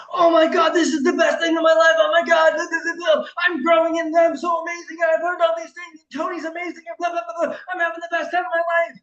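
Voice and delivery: high, loud mock-gushing shout; gushing attendee bragging to family, hammed up. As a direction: ham up the over-excited attendee. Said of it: "and went".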